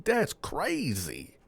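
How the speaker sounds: slow, soft disbelief; quiet amazed murmur; stunned. Real, not acted. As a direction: let it sink in slowly. Stunned reaction to gossip.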